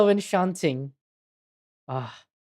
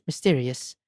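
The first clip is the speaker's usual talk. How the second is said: normal talking voice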